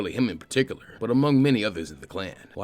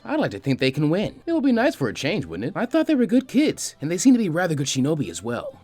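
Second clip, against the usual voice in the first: hopeful assistant; 6 semitones higher than usual. Acted. Shizune hopefully voiced, higher.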